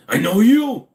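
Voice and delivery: high, loud burst of recognition; excited shout. Real, not acted. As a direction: call out loudly to someone familiar. Calls out on recognizing a viewer.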